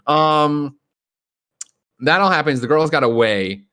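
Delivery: ordinary delivery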